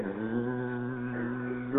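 low, soft buzzing bee sound. Retold or imitated. Preacher imitates the bee with a soft low buzz.

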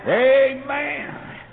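high loud drawn-out amen; fervent amen. Real, not acted. Preacher crying amen to the church, high and loud. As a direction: ring it out.

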